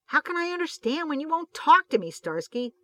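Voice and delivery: agitated interruption to his partner; agitated outburst. A narrator's reading. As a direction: cut in, upset and loud. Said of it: "Hutch interrupted, agitated".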